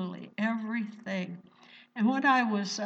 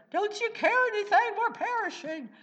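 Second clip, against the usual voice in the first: much higher, fast and frantic; panicked disciples; 12 semitones higher than usual. Retold or imitated. Disciples crying out to the sleeping Jesus in panic. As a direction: cry out over the storm in alarm.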